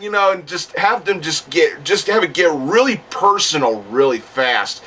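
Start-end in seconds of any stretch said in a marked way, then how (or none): none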